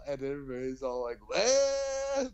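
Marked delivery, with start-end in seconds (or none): 1.3-2.3 high mock cartoon scream "Wah!"; a hammed-up panicked cartoon wail. Describing the crash scene, a mock cartoon scream. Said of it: "like, cartoon screaming".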